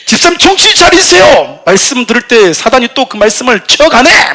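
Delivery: very high, loud, slow preacher yell; fiery wake-up shout. Retold or imitated. Preacher shouting at a sleeping deacon, high loud slow yell. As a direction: yell at the dozing deacon to snap out of it.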